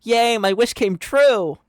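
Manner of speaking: high, loud, drawn-out cheer; happy yell. Acted. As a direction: yell it out in hammed-up delight. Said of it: "Max yelled happily".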